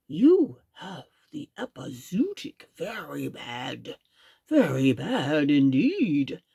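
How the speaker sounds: slow, low grave diagnosis; doctor rabbit. A narrator's reading. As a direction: play the serious doctor. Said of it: "Uncle Wiggily said".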